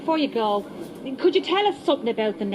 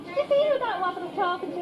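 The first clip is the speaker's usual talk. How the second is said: high, fast, breathless excitement; starstruck gasp; 11 semitones higher, 3 dB quieter than usual. Acted. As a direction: gush about the famous customer. Starstruck market woman.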